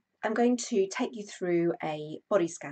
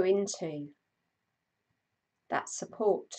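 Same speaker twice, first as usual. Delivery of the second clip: guided meditation; 3 dB louder than usual. Acted. Host guiding a live stream body scan, calm meditation voice. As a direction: ease listeners into stillness, unhurried.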